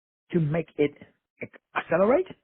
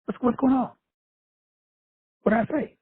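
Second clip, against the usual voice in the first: "Oh, damn" jumps higher, puzzled; mock surprise; 5 semitones higher than usual. Retelling his reaction to an insult, mock surprise. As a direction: act caught off guard by the insult. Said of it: "I said".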